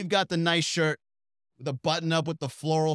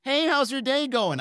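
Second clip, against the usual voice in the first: mock friendly girl; 10 semitones higher, 4 dB louder than usual. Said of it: "when they wanna be your friend".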